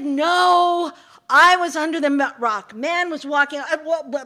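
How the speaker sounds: sputtering frustration; flustered snake. Acted. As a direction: snake getting muddled while yelling. Snake flustered, tongue-tied.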